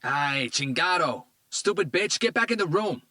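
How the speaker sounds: put-on man ordering his girlfriend away; furious yell. A narrator's reading. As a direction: bark it at her. Tito yells at woman.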